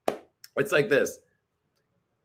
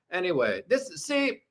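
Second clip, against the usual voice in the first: ordinary delivery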